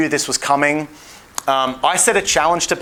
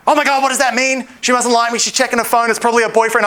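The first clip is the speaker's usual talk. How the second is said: 1 mock panic; 8 semitones higher than usual. Mocking nervous guy overthinking.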